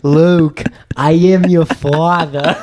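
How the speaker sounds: a light, jokey Vader send-up; Darth Vader impression. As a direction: ham up the famous line as Vader. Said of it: "Like Darth Vader".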